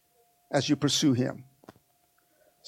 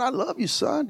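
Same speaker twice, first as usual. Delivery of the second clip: normal talking voice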